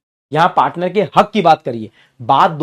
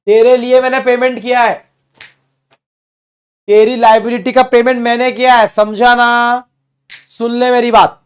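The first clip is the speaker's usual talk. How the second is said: loud, high insistent partner; raised voice of a partner claiming his due; partner demanding; 5 semitones higher, 6 dB louder than usual. Acted. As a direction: play the partner insisting to the firm. Teacher voicing partner demanding from firm.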